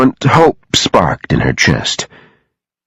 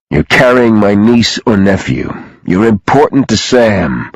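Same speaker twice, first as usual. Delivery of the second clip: plain reading voice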